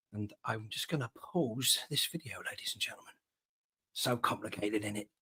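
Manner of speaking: hushed whispered aside. Real, not acted. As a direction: drop to a breathy whisper, off mic. Whispering aside while pausing video.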